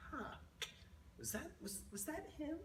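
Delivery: put-on high, soft surprised girl, breathy murmur; curious girl voice. Girls spotting the newly shredded guy, high and hushed. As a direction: wonder aloud if that's really him.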